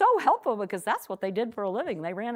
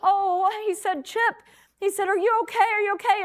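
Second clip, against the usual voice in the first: higher-pitched, eager, worried dad; excited relief; 9 semitones higher than usual. Retold or imitated. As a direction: rush to the son, relieved he's alive. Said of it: "so excited that his son is actually alive".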